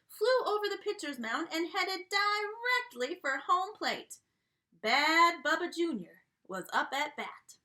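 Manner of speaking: drawling cowboy storyteller voice; a jolly country restaurant owner spinning his yarn; folksy dog chef. Acted. Reading to kids as Yodeler Jones, folksy country voice.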